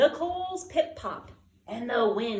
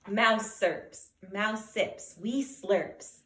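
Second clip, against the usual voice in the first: plain reading voice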